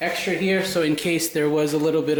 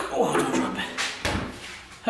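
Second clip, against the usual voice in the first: whispering; 4 dB quieter, much slower than usual. Real, not acted. Careful carrying, to self.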